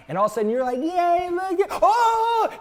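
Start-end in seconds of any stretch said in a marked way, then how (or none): 0.8-1.7 high-pitched mock cheering; retold celebration. Retold celebration before bulls appear. 1.8-2.6 very high startled scream; imitated alarm cry. Retold alarm at bulls darting around.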